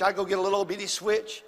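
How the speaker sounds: normal talking voice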